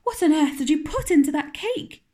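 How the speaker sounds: put-on startled housekeeper questioning the girl; alarmed. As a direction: press her hard about the cake. Marilla alarmed at the cake.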